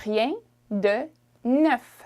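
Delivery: slow "rien de neuf", every sound clear; slow careful version. Shown as a demonstration. Teacher slowing the phrase for learners. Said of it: "you would say".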